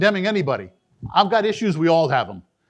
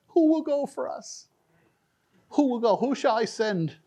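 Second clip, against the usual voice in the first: higher, softer call of God; soft divine call; 4 semitones higher, 3 dB quieter than usual. Acted. Preacher voicing God's call to Isaiah, higher and gentle. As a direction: ask it gently like God calling.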